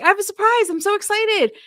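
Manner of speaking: high, bright-eyed excited mimic; mock excited Sean. Sean bursting in to tell Megan, mock excited. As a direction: squeal it like an eager boyfriend. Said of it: "he's like".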